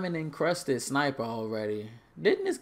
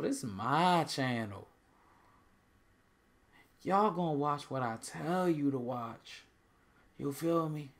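slightly raised mock-assertive character voice; unidentified put-on voice; 4 semitones higher than usual. Streamer playfully laying down the law to viewers about his channel, lightly put-on voice. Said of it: "I don't know what voice that is".

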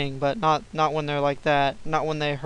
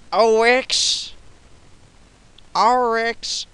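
higher, louder call at the boss; mock battle taunt; 6 semitones higher, 5 dB louder, much slower than usual. Real, not acted. Gamer taunting the boss Oryx, louder mock shout.

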